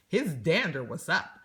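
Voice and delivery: ordinary delivery, nothing marked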